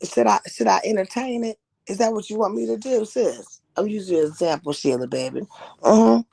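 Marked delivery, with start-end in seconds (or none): none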